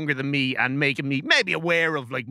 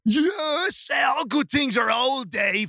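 pompous pronouncement; 7 semitones higher, slower than usual. Acted. Pompous pronouncement on old things.

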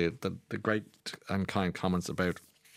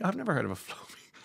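laughing; 9 semitones higher, 3 dB quieter, faster than usual. Real, not acted. Laughing at the Flowbee.

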